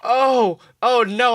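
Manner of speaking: usual speaking voice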